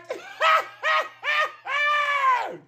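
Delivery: shrieking laugh; loud high laugh. Real, not acted. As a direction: burst into a high cackle.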